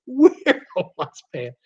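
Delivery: "We're the ones paying" said through a chuckle; amused, laughing repeat. Real, not acted. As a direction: let the laugh spill into the repeat. Repeats the line while laughing.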